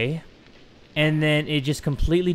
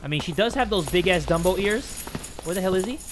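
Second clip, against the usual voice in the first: ordinary delivery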